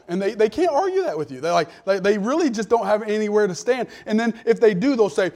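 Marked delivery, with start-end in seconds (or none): none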